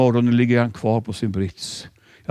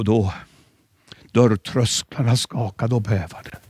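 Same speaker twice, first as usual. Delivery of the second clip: ordinary talk, nothing marked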